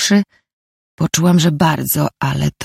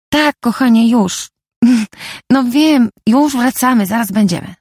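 higher-pitched, placating phone reply; explaining herself; 8 semitones higher than usual. A narrator's reading. Jolka tells her partner on the phone they're coming back, placating. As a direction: reassure an impatient partner in a raised pitch. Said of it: "tłumaczyła się".

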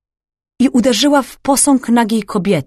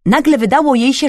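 ordinary delivery

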